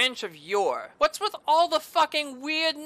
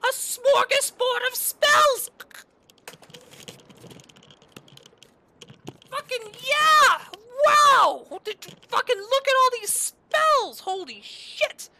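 much higher and louder, slow gushing cries of joy; ecstatic shout; 13 semitones higher, 5 dB louder, slower than usual. Real, not acted. Gamer finally finding the magic seller, ecstatic shouting. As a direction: scream with pure delight at the loot. Finds the magic vendor's spells.

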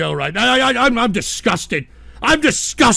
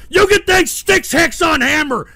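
high, raised voice of blame; heated shout; angry threat; 6 semitones higher than usual. Real, not acted. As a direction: lash out at the one to blame. Threatens to end the show.

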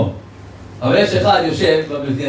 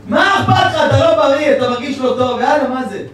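alarmed outcry; 9 semitones higher than usual. Retold or imitated. Shamash to the rabbi after the heart attack, high alarmed outcry.

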